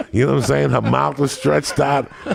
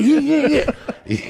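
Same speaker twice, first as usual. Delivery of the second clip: put-on confused girl voice.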